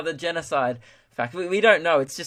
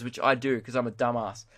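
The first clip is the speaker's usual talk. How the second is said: ordinary talk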